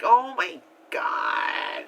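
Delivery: put-on slow, drawn-out New York accent. Tourist hearing sirens in New York, slow put-on New York accent. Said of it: "Why am I doing a New York accent".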